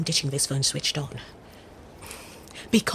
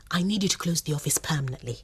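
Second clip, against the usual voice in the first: urgent whispered order down the phone; 3 semitones lower, 6 dB quieter than usual. Acted. Woman on a secret call whispers an order to close the office. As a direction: keep it under your breath, all air.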